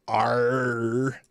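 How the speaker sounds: lightly growled pirate arr; a short mock pirate snarl; playful pirate noise for viewers. Talk Like a Pirate Day.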